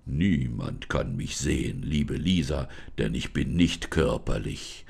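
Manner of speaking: low, slow spirit voice, calm and grand; forest spirit, solemn. Acted. Forest spirit introduces himself to Lisa, low and solemn.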